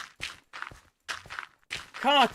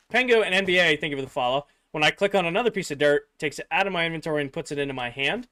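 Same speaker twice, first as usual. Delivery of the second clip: ordinary talk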